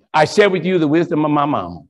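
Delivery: normal talking voice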